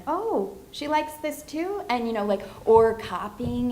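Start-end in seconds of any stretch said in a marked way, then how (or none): 0.1-1.8 put-on child's thought. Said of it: "kind of be like".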